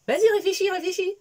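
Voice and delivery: insistent urging. Retold or imitated. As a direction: push him to think, teasing. Presse son mari de réfléchir. (insiste plus fort et plus aigu)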